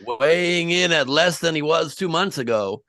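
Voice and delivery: loud, high ring announcer voice; boxing announcer. Pretend. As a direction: announce him like a boxing match.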